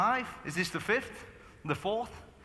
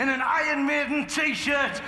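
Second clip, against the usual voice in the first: usual speaking voice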